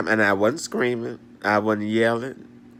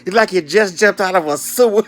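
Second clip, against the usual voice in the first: high and loud mock insult; mock scold; 7 semitones higher, 7 dB louder than usual. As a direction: hurl it at them, laughing inside. Insulting strangers, retold.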